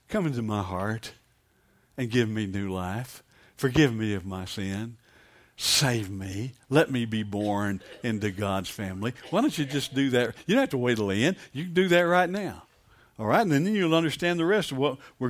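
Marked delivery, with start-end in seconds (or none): 0.1-9.1 low, soft, earnest pleading; hushed prayer. Shown as a demonstration. Preacher leading doubters in a salvation prayer, low and hushed. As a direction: pray it quietly, phrase by phrase.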